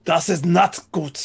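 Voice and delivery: mock German accent, lightly done. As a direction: play the German villain. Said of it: "before that, it was the Germans".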